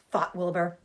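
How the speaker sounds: usual speaking voice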